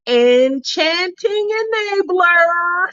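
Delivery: high, drawn-out title reveal; grand award announcement. Pretend. Host revealing her next award, grand and drawn out. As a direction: stretch the title out with a playful flourish.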